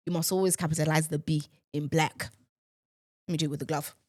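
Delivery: ordinary talk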